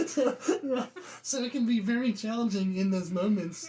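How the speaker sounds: talking through laughter; laughing. Real, not acted. Host laughing at her own quarrel story, laughing.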